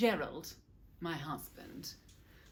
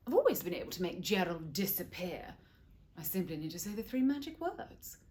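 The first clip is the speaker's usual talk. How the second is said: plain reading voice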